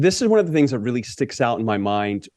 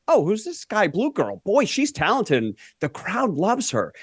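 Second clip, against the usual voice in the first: higher-pitched admiring surprise, acted out; impressed discovery; 7 semitones higher than usual. Interviewer relives first seeing her in Chicago, impressed. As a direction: voice a fan just discovering a talent.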